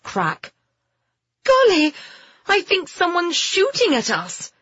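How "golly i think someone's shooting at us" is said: put-on high-pitched girl's cry of alarm; urgent, pitched way up; alarmed shout. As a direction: shout a startled warning. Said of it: "Phoebe shouted".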